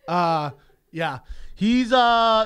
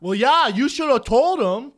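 loud, drawn-out Wisconsin drawl; mock Wisconsin accent; 4 semitones higher, 9 dB louder, slower than usual. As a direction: parody how Wisconsin locals talk. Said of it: "Why do they talk like that?".

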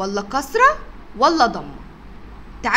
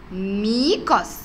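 slow drawn-out word; much slower than usual. Shown as a demonstration. Teacher stretches ميكس slowly for the children. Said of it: "الشكل اللي بعده".

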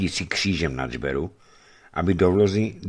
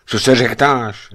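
loud, raised, irritated question; grumpy exclamation; 6 semitones higher, 7 dB louder than usual. A narrator's reading. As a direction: call it out crossly, pitched up. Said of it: "zvolal mrzutě hromotluk". (mrzuté zvolání)

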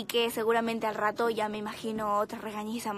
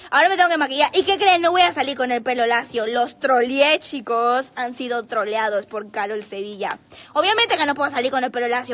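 excited reveal; 7 dB louder than usual. Real, not acted. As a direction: tease the fans with a big reveal. Excited reveal, trolling fans. (anuncio emocionado y fuerte)

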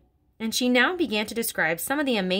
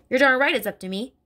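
louder, higher bellow; 5 semitones higher, 6 dB louder than usual. Acted. Headmistress asserting power over Miss Honey, bellow. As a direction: bellow it in triumph. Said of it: "Miss Trunchbull bellowed".

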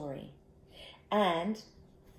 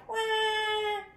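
put-on high-pitched baby bear wail; play-cry in a tiny high voice; pretend cry. A narrator's reading. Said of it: "Everybody cried".

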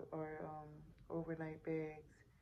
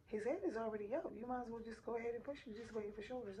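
higher, singsong staff voice; mock insistence, pitched up; imitating insistent staff; 5 semitones higher than usual. Staff telling mother the head is out, insistent. Retelling staff urging her on.

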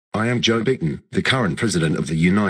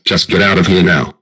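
loud, angry order to leave; angry dismissal; 8 dB louder than usual. Acted. Biden orders the intruder out of his mouth, angry. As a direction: throw them out at full volume.

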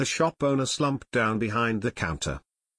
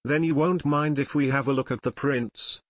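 ordinary delivery, nothing marked

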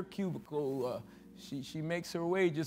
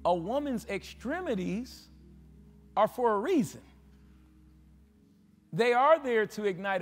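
higher, louder preaching to the crowd; fiery preaching; 5 semitones higher, 4 dB louder than usual. Acted. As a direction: preach it hard, pushing each line up. Preaching at full force, raised voice.

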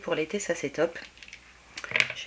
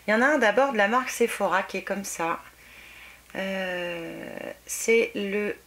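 ordinary delivery

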